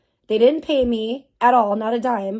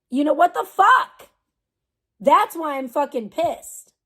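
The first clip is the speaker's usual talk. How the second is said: exasperated outburst; 4 semitones higher, 3 dB louder than usual. Real, not acted. Angry outburst at company.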